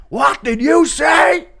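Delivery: high, loud, slow cartoon shout; drawn-out high shouted question; character voice. Shown as a demonstration. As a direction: yell it as an outraged cartoon character. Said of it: "I do all of the voices".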